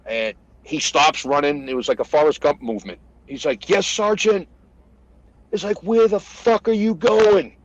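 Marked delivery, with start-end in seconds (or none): 3.7-4.5 higher-pitched eager soldier; lightly acted recruit voice; retold private's reply. Acts out the private's startled reply. 5.8-7.6 high, slow, drawn-out holler; imitated incredulous yell; retold shouted demand. Sergeant shouting after running private.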